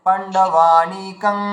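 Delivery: পাণ্ডবানিকং said very slowly, ending in a nasal ং; drawn-out পাণ্ডবানিকং closing on a nasal hum; teacher showing the anusvara ending. Shown as a demonstration. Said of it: "আর একটা অনুস্বর বলা যায়".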